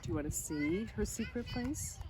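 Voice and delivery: ordinary delivery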